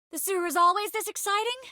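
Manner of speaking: high, quick questioning line; high-pitched, hurried query. Acted. Raised-voice quip in game sewer scene.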